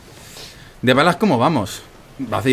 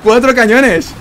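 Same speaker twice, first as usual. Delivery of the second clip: high, loud furious yell; raging gamer; 11 semitones higher, 8 dB louder, slower than usual. Real, not acted. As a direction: shout it out in disbelief. Raging at enemy cannons.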